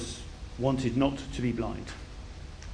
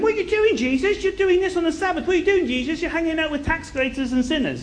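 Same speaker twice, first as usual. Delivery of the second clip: mock outraged accusers; 12 semitones higher than usual. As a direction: imitate busybodies scolding, pitched way up. Mimicking critics accusing Jesus.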